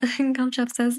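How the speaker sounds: ordinary delivery, nothing marked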